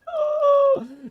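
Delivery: loud shocked gasp, very high; sharp high gasp. Real, not acted. Run collapses.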